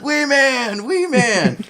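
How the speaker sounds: high, calling out across the pier; mock yell. Imitating Tarantino calling to him from the restaurants, mock yell. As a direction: shout the name like hailing someone from afar. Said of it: "Didn't you hear me yelling?".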